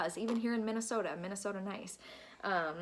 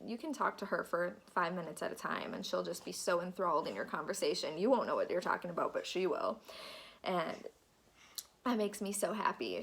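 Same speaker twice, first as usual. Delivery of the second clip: normal talking voice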